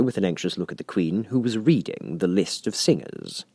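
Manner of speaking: plain reading voice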